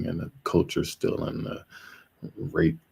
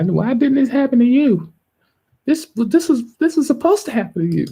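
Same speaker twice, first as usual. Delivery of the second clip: much higher, loud, mocking whine; envious whiner; 7 dB louder than usual. Envious people asking why it didn't happen to you, high mocking whine. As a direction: mimic the envious crowd in a shrill put-on voice. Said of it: "people who are gonna be envious".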